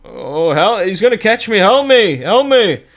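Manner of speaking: high, loud cries for help; panicked yelling. Acted. Haggard man fleeing something behind him, panicked yelling. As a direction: yell in fright as if being chased. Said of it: "I think he's yelling".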